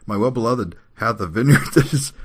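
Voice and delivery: high, loud recitation breaking into laughter; real laughter leaking into a scripture verse; laughing while reading. Real, not acted. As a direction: try to read on and crack up. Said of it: "try to read this without laughing".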